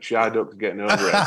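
broad Yorkshire, slow and laughing; Yorkshire accent. Retold or imitated. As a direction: say it like a proud Yorkshireman. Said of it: "in a Yorkshire accent".

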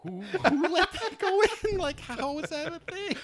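high, laughing disbelief; high incredulous voice. Retold or imitated. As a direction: squeal it in disbelief. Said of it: "and I'm like".

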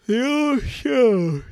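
yawning through the words; stretched out in a yawn. Real, not acted. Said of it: "Sorry about my yawn there".

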